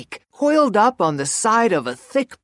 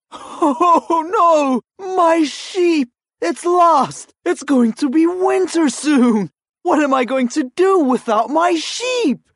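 higher, fretful villager voice; a villager panicking over a lost sheep; worried villager; 9 semitones higher, 3 dB louder than usual. Acted.

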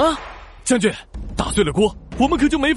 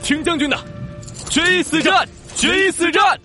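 soldiers' battle cry; 9 semitones higher than usual. Acted. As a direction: roar back the oath. (士兵齐声呐喊)

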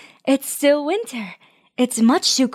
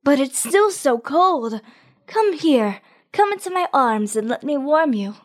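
tender sunshine voice. Acted. Sunshine comforts shoot.

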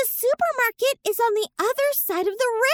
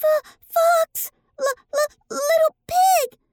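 higher, stuttered cry for rescue; stuttering plea for help; 4 semitones higher than usual. Acted. Bunny stuck in the ice calling friends to save her. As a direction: stammer then beg slowly.

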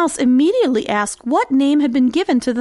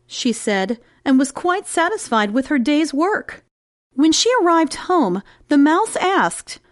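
plain reading voice

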